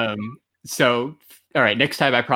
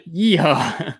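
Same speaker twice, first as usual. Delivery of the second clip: yeehaw; 4 semitones higher than usual. Shown as a demonstration. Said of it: "It says".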